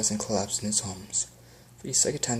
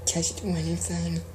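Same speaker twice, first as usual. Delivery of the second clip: mild pleading question, pitched up; begging; 7 semitones higher than usual. Acted. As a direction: beg her for help. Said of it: "Faye begged".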